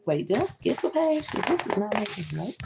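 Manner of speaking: usual speaking voice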